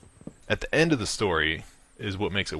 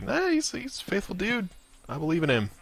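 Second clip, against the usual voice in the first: laid-back God in a much higher voice; voicing God; 12 semitones higher than usual. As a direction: shrug it off, God trusting his faithful dude. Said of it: "God's like".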